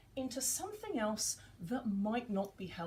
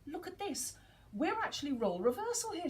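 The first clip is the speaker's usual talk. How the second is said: sudden realisation; 7 semitones higher than usual. Retold or imitated. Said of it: "before I thought".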